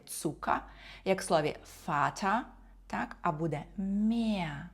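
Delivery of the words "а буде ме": usual speaking voice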